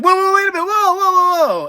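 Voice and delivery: high panicked whoa whoa; shocked protest. Retold or imitated. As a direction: cry out in disbelief. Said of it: "M J F is like".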